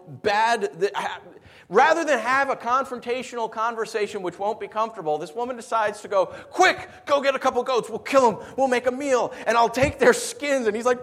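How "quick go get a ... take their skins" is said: loud, higher urgent orders; urgent scheming. Acted. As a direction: act out a hurried plan, loud and high. Acting out Rebecca's urgent scheme.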